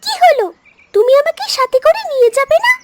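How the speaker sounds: duckling voice. Acted. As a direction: ask it like a small duckling afraid of being left. Duckling speaking in a story.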